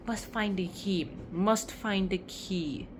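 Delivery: slow, drawn-out quiet self-talk; intent muttering. Acted. Girl to herself while hunting a key, intent muttering. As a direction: mutter it slowly to yourself.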